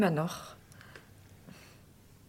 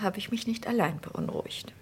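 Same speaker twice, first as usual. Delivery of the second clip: whisper; 4 semitones higher, 5 dB quieter than usual. Acted. Sly remark between rival women.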